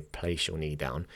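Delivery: soft, low calm cue; calmer. Shown as a demonstration. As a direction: settle them with a quiet instruction. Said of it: "to be a little calmer before you say".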